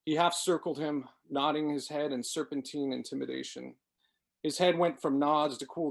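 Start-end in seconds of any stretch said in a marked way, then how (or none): none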